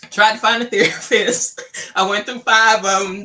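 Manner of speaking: amused, laugh-broken telling. Real, not acted. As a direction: recount it with real amusement breaking in. Laughs while recounting therapist search.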